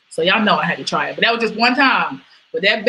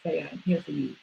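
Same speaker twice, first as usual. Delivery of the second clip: softer, tender; 3 semitones lower, 11 dB quieter, faster than usual. Real, not acted. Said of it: "softer repeat".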